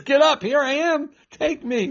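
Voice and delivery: comic high-pitched exclamation; high, animated mock command; Jesus offering himself. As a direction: act it out way up high, playfully exasperated. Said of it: "And he says".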